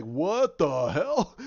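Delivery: loud, drawn-out mock disbelief; stunned, slow retold outcry; acted astonishment. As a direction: stretch it out slowly and loudly in acted shock. Astonished hero in retold scene.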